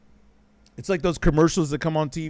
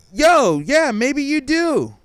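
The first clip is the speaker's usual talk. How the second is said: mock agreement; 9 semitones higher, 5 dB louder, slower than usual.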